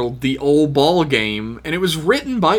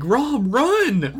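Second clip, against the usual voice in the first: retold cheering; 9 semitones higher, much slower than usual. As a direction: urge the runner on. Team yelling at a runner.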